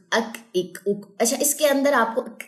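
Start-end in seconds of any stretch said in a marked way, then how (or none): 0.1-1.1 "اک" ending in an audible puff of k; breathy k release. Shown as a demonstration. Teacher showing learners kaf with jazm, "اک" with breathy k release. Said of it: "یہ sound آ رہی ہوگی". 2.2-2.4 "اک" with a puff of air after the k; hams on kaf. Shown as a demonstration. Teacher letting learners hear hams, breathy k in "اک". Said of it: "یہ ہمس ہے".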